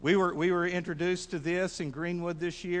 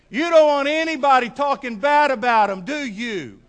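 very loud, raised-pitch appeal; forceful challenge; 8 semitones higher, 12 dB louder than usual. Real, not acted. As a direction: drive it home to the congregation. Challenging congregation after rousing questions.

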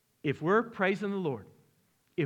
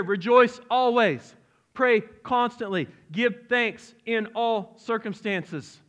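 slow, loud, higher proclamation; scripture proclaimed; 5 semitones higher, 5 dB louder, slower than usual. Real, not acted. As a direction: proclaim each command. Reading scripture with force.

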